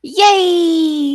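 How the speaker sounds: high, loud happy yay; excited cheer. Real, not acted. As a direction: cheer for the winner. Winner appears on screen.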